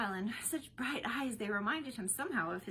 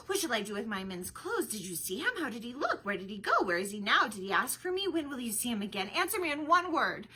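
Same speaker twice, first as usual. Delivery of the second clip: rapid excited questions; 3 semitones higher, 7 dB louder than usual. A narrator's reading. Rosalind bursting with questions to Celia, excited and rushing. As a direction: fire off every question without a breath. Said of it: "began to speak very, very fast".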